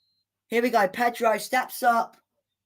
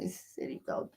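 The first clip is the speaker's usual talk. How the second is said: whispered, much softer with a laugh; whispering to the dog, amused; 16 dB quieter than usual. Real, not acted. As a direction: breathe it to the dog fondly.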